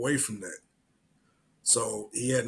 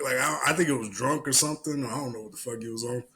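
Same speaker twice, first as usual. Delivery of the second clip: ordinary talk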